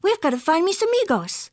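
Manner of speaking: put-on high, urging kitty-boy voice; calling. A narrator's reading. Kitty boy needing to find his amigos, calling. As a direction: urge them on, pitched high. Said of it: "called the kitty boy".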